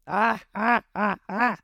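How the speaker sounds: high, spaced-out mock cackle; villain laugh. A narrator's reading. Krieg gloating over collapsed Jin, staged villain laugh. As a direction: laugh in separate, deliberate beats.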